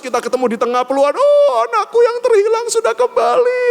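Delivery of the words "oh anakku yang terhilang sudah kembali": loud-edged falsetto melodrama; Korean drama mother. Pretend. Mother welcoming her lost child back, hammed-up falsetto. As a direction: ham up a high, tearful-style reunion cry. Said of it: "kayak drama Korea".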